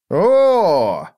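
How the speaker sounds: high, loud "oh" of delight; delighted gasp. A narrator's reading. Friend sees the coins, delighted. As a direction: let out a big impressed "oh". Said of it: "явно от восторга".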